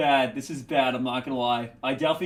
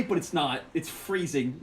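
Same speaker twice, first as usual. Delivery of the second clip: ordinary talk, nothing marked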